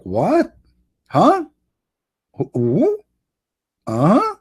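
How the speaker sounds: high startled huh, lost who-who; a girl totally confused by the question; baffled. Acted. Fiancée hearing the riddle repeated, squeaking in confusion.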